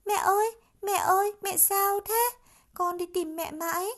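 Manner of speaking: put-on high, loud, eager child's voice; chattering child. A narrator's reading. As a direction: chatter to mom in relief. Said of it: "ríu rít". (khỉ con ríu rít với mẹ, giọng cao, mừng rỡ)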